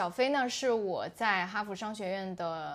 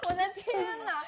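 high burst of laughter; laughing hard. Real, not acted. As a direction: crack up completely. Host cracks up at slip.

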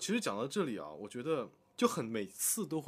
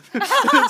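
high loud burst of laughter; 19 dB louder than usual. Real, not acted. Laugh after mock line.

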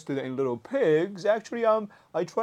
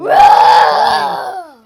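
loud spooky groan; 11 semitones higher, 18 dB louder than usual. Pretend.

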